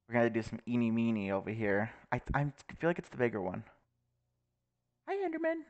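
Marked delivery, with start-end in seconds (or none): 5.1-5.6 much higher, sing-song hello; cutesy greeting. Acted. Player greets the Enderman in a high cutesy voice. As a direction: coo at it like a pet.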